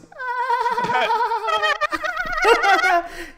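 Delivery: high squeaky dolphin chatter; dolphin squeal. Pretend. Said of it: "I can use my dolphin voice".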